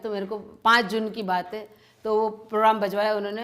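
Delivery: ordinary talk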